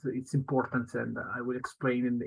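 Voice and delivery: ordinary delivery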